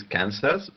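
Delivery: "Kansas" with the final S sounded; contrasting pronunciation. Shown as a demonstration. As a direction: say "Kansas" as spelt, ending in "-zas". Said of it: "but this is Arkansas".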